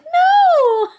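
squealed high no; high shout. Retold or imitated. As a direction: yelp it high and loud. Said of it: "And I'm like".

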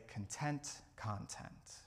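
a soft, very slow breath-out mantra; slow calm mantra. Shown as a demonstration. Preacher showing the congregation how to breathe out the mantra, softly and slowly. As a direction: exhale and say it slowly, softly, like a release. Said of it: "take a moment and breathe in".

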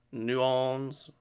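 New Orleans run together as "noo-AWNS"; New Orleans with the middle swallowed; contracted pronunciation. Shown as a demonstration. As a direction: slur New Orleans into "noo awlns", dropping the r. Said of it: "depends how you say it".

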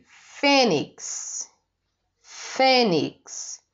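fênix with a long, strong first syllable; teacher sounding out fênix, fê strongest; stress on FÊ. Shown as a demonstration. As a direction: lean on fê and let nix fall. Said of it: "é a sílaba mais forte".

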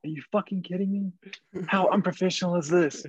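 hammed-up outraged rant at a player; imitating a yelling coach. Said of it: "I'm yelling at you".

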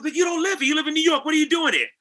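high, surprised woman's voice, pitched well up; a startled woman who finds him at her door; surprised casting director. Retold or imitated. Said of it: "She goes".

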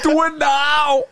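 very high, loud, slow German command; German mom accent. Retold or imitated. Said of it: "That was an impression of his mom".

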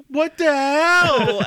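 high, loud, drawn-out mock anger; mock outrage. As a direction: imitate an outraged customer loudly. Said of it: "And you were so mad".

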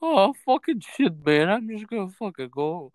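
a lightly voiced, hammed-up Bubbles losing it; Bubbles impression. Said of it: "I'm not good at impressions".

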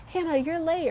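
high-pitched imitation of a classmate; classmate calling out. Friend calling out a late arrival, high mock call-out. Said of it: "she basically called me out".

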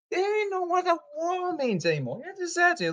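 high squeaky mocking voice; mock them in a high squeak. Mocking social media, squeaky voice.

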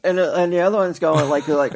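ordinary delivery

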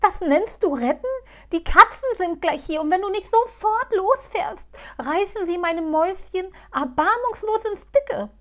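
high, loud despairing plea; desperate. Acted. Halbschuh desperate as the cats reach the car. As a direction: beg in despair. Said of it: "blickte verzweifelt".

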